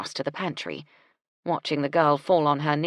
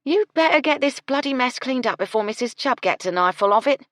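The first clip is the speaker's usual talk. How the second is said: louder, higher, brisk orders; bossy cook; 8 semitones higher, 7 dB louder than usual. Acted. Gertie orders Doris to clean up before Mrs Chubb, bossy. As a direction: boss the maid about loudly.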